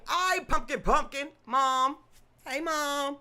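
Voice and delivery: high, loud happy hello; excited greeting. Real, not acted. Greeting mom as she joins the chat, high excited call.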